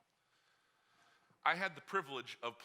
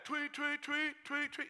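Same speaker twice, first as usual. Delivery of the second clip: high mock whistle tweets; whistle imitation; 11 semitones higher, slower than usual. Coach blowing the whistle, lightly mimicked. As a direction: chirp it like a whistle.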